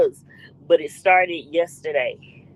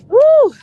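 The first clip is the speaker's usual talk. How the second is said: loud high "woo"; short, piercing whoop; 10 dB louder than usual. Real, not acted. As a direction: let out a big holler. Whoops between greetings.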